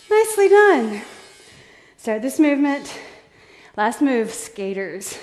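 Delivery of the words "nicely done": high, loud cheer; excited praise. Real, not acted. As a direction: cheer them on. Coach praising the group.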